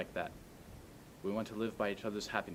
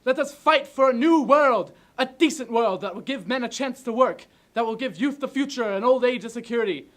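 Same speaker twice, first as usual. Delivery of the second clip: exploding, loud, high-pitched oration; ranting dictator speech; 19 dB louder than usual. Acted. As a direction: burst out with everything left. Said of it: "do it like you're just exploding".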